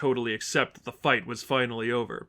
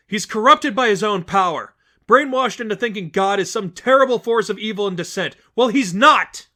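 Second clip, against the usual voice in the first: higher, louder defiant outburst; Stephanie, fierce; 9 semitones higher, 9 dB louder than usual. Acted. Stephanie denouncing the Seraphim to the crowd, fierce. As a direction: slam the corrupt leader.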